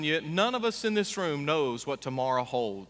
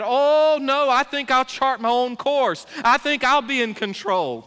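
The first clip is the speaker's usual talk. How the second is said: a hint of a proud doubter, loud and high; mock defiant listener; 7 semitones higher, 6 dB louder than usual. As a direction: lightly voice the self-reliant sinner. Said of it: "Do you receive that?".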